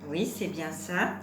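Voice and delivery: ordinary delivery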